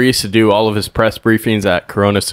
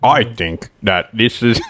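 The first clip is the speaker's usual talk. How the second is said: slow; slower than usual. Retold or imitated. Mocking Mark's slow answer.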